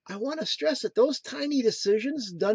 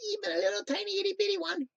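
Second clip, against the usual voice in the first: put-on very high, quick, teeny little voice; tiny squeaky voice; 13 semitones higher, much faster than usual. Speaker stressing even the smallest promise, tiny high voice. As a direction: squeeze it up high and rush it, itty-bitty.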